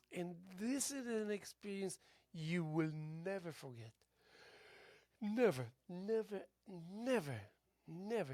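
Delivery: rising, insistent never; emphatic. Real, not acted. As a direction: press each never harder.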